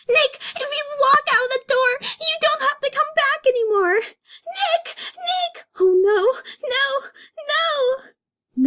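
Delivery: high, loud mock wailing; hammed-up sobbing. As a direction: overact a tearful breakdown. Judy sobs as Nick leaves.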